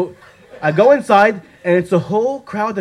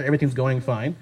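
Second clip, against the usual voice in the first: usual speaking voice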